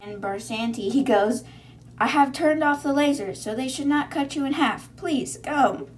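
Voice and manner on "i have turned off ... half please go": higher, louder mock guard announcement; mock formal guard. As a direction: ham up a stiff guard announcing. Guard's quote retold in voice.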